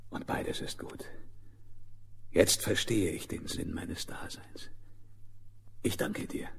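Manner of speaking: hushed reverent whisper. Acted. Lion's quiet thanks and understanding.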